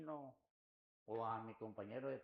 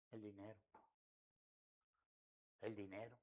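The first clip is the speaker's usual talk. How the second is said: quiet heavy admission; 5 semitones lower, 11 dB quieter than usual. Retold or imitated.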